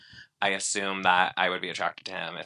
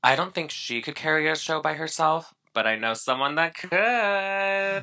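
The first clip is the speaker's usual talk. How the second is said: teasing sing-song; 8 semitones higher, 4 dB louder than usual. Real, not acted. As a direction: drop a playful hint about yourself. Teasing hint at herself.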